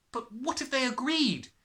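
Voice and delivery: put-on high, bright eager child; a girl hoping out loud; eager cry. A narrator's reading. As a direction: jump at a new hope. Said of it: "Matilda cried eagerly".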